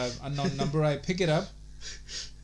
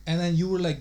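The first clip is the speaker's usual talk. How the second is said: ordinary talk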